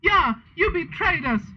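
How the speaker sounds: high-pitched acted accusation; hammed-up angry outburst; angry shout. A narrator's reading. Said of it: "shouted Vampire angrily".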